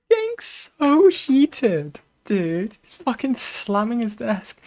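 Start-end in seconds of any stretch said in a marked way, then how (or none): 0.1-2.8 high, drawn-out, laughing incredulity; laughing through it, pitched way up; amused disbelief. Real, not acted. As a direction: crack up at someone losing it, stretching the words. Laughing at a heated caller.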